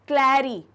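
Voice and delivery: "क्लैरी" called out loudly with its opening cl hit hard; teacher loudly stressing the cl in "क्लैरी"; stressed cl onset. Shown as a demonstration. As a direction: bark "क्लैरी" at the class, punching the cl. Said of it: "क्लै sound आ रहा है".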